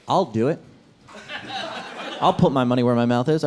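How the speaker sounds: ordinary talk, nothing marked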